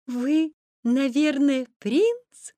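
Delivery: put-on very high, slow, curious child; high-pitched, drawn-out girlish question; little girl. A narrator's reading. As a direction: ask in a small girl's high voice. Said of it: "спросила девочка".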